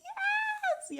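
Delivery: very high squealing yes with a laugh; ecstatic squeal. Real, not acted. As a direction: squeal with pure joy. Beat drops, she loses it.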